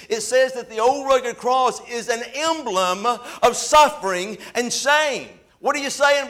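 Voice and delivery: high, ringing preacher's delivery; full-force preaching. Real, not acted.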